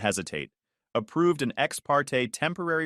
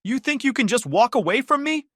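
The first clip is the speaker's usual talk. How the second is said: high furious scream; 10 semitones higher, 5 dB louder than usual. A narrator's reading. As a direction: scream it in his face.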